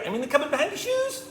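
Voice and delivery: high, squeaky, eager give-away; little girl mouse. Acted. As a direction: blurt it out in a tiny mouse voice. Girl mouse eagerly gives away hiding place.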